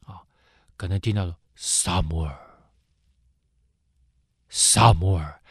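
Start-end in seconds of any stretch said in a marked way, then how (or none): 1.6-5.4 low, distant call of God; God calling Samuel in the night, low and distant. Acted. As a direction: call the boy's name from far away, lower. God calls the sleeping boy.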